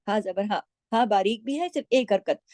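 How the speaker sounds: ordinary talk, nothing marked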